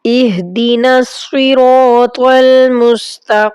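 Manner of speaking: slow, drawn-out Arabic recitation. Shown as a demonstration. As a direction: recite each word slowly and clearly. Teacher recites prayer slowly.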